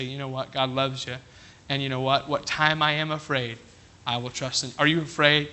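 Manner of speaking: put-on soft, low, hushed comfort to a child; gentle dad. Father softly reassuring his frightened child at bedtime, hushed and low. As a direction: settle a scared kid down softly.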